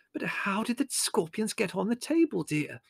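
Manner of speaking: high, soft, puzzled lady's voice; bewildered mother. Acted. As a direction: ask gently in a high voice, all at sea. Mother bewildered amid uproar.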